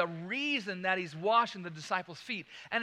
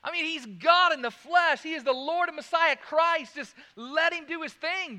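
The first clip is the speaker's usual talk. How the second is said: raised, higher coaxing plea; egging on a friend, lightly acted; mock urging; 8 semitones higher, 9 dB louder than usual. Disciple urging Peter in a raised, coaxing voice.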